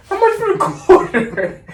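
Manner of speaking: high laughing squeak; Gary Vee impression. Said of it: "He's like".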